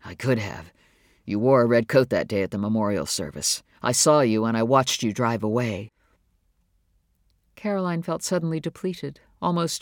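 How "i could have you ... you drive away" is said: low-pitched man's voice, roughened; rough voice. A narrator's reading. David confessing he watched Caroline drive away, in a rough voice. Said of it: "His voice was rough".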